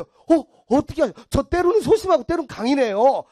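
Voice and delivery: high, loud mock amazement; gullible excitement. Gullible woman agreeing with palm reader, high excited gushing. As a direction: gush as if totally convinced.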